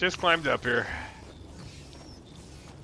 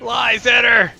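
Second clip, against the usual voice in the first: playful shout; 9 semitones higher, 8 dB louder, slower than usual. Real, not acted. Playful accusation at teammate.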